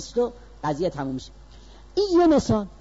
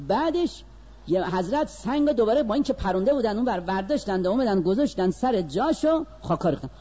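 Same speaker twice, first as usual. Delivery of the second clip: ordinary delivery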